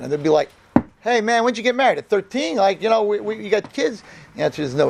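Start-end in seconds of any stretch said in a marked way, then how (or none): 1.0-4.0 high, teasing buddy voice; mocking friends. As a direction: rib him like a smirking classmate. Friends mocking over missing iPhone.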